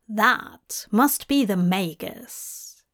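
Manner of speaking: plain reading voice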